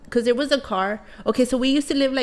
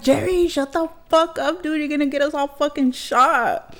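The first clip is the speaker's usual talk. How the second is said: retold angry yell; 7 semitones higher than usual. Yelling at stepdad to stop.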